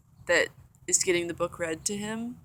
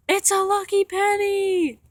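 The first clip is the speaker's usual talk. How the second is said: high-pitched, louder, slower retold yell of surprise; excited shout; 13 semitones higher, 6 dB louder than usual. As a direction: squeal it like you just spotted treasure. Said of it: "pointing at the ground and shouting".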